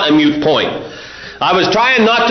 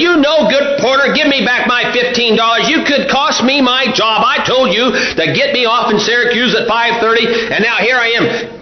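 acted angry outburst, higher pitched; upset passenger; 4 semitones higher than usual. Cheated passenger berating the porter, acted angry. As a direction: tear into the porter in mock outrage. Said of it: "Man, he got all upset".

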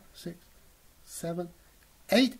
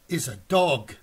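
usual speaking voice

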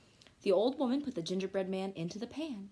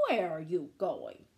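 sly fox; 6 semitones lower than usual. Acted. Fox asks.

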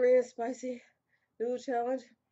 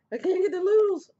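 much higher, louder strained cry; 10 semitones higher, 9 dB louder than usual. Real, not acted. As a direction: cry out in frustration at the burn. Struggling with hot noodles.

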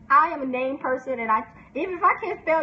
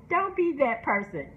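ordinary talk

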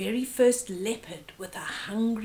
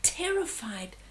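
ordinary delivery, nothing marked

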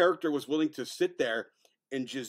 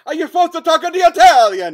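very loud, high-pitched hammed-up outrage; mock outraged yell; 11 semitones higher, 15 dB louder than usual. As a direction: yell at him in exaggerated disbelief. Mock yelling at the actor.

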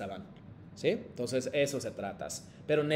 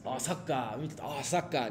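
un "Ah, saca" suave de aficionado, imitado; imitando a un aficionado; 5 dB quieter than usual. Retold or imitated. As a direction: imita al que grita "saca" viendo fútbol. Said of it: "A lo mejor por ahí salen". (imitación ligera de un aficionado al fútbol)

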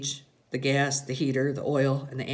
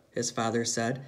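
usual speaking voice

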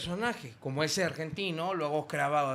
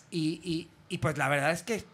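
ordinary delivery

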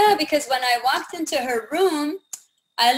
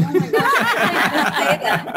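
laughing embarrassment; 3 semitones higher, 4 dB louder, faster than usual. Retold or imitated. Embarrassed at blurting it to a customer, laughing. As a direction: giggle at her own slip.

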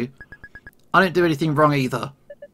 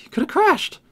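very high, frightened shriek; panicked cry. Acted. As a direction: cry out in terror at him. Jun horrified at dad.

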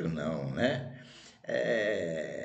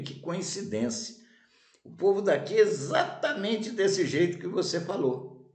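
sly sage reply; 10 semitones higher, 3 dB louder than usual. Acted. Sage answers the first wanderer.

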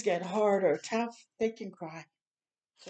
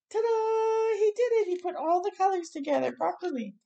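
excited ta-da; 11 semitones higher than usual. Acted. To a child, cheering the boy's solved cube: excited, much higher ta-da. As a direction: cheer the big reveal with a bright shout.

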